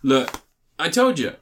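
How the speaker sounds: ordinary delivery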